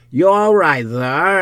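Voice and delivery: loud, high concerned call; calling through door. Acted. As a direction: call out to check on someone behind a door. Hall calls through the door.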